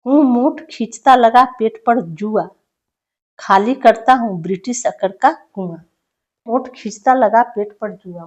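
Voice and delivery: poem recitation. Acted. Teacher reading a prisoner's poem aloud to students, declaiming. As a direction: recite each line with weight to the class.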